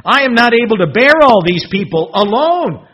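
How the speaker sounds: loud, exasperated Moses; frustrated outcry. Acted. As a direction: throw up your hands and cry out. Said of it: "He's frustrated".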